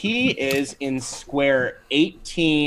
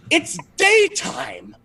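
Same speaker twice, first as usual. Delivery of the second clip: outraged Red Cap; 14 semitones higher than usual. Acted. Red Cap facing the vampire by day, high outraged shout. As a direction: shriek it like the rules were broken. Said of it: "he looks at you and he goes".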